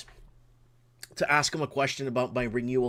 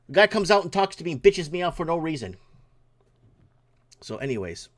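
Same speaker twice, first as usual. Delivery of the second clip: ordinary talk, nothing marked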